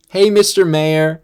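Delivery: high, loud, drawn-out mimicked hail; mock calling out. Lady with her daughter hailing the mayor, mock calling out. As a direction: call out like a lady from the roadside. Imitated lady calls from roadside.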